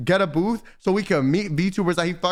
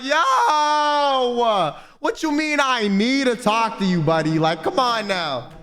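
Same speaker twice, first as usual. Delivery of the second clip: high, slow mock outrage at him; incredulous pushback; 7 semitones higher, slower than usual. Streamer retorting to the lawyer, mock indignant. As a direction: drag it out in disbelief. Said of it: "I was like".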